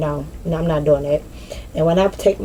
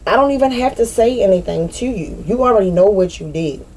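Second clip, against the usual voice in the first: normal talking voice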